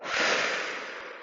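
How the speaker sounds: a voiceless, soft exhale pushed right out; empty the lungs audibly; whooshing demo exhale; big breath out. Shown as a demonstration. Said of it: "pushing out as much air".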